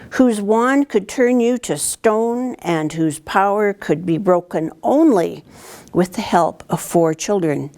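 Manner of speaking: ordinary delivery, nothing marked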